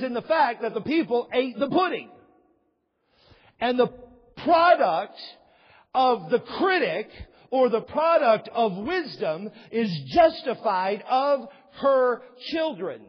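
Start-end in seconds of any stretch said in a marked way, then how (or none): 3.6-13.0 louder and slow, hammering product; emphatic pulpit delivery; preaching loud. Acted. Preaching climax.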